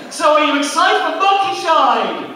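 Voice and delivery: high, loud crowd-warming call; hype-up announcer. Acted. Comic firing up the audience, high and loud. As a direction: whip the room up. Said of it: "Let's see if we can handle this".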